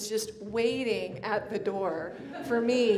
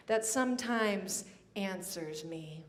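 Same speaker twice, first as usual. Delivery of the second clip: ordinary talk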